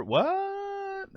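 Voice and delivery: a real jolt of surprise at the chat; surprised what. Real, not acted. Reading a chat question, startled and incredulous.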